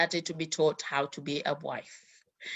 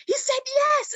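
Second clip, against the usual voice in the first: much higher, thrilled shriek of joy; excited aunt; 5 dB louder than usual. Retold or imitated. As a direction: squeal the big news. Aunt bursts in with the news.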